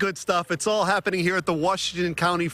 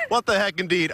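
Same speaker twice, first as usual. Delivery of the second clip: laughing; 7 semitones higher than usual. Real, not acted.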